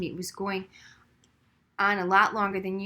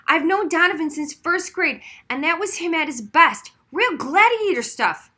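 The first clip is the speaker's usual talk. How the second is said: loud and high, thrilled; hyped-up kid praising a friend; excited; 7 semitones higher, 8 dB louder than usual. A narrator's reading. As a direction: gush about the fight. Daniel still fired up.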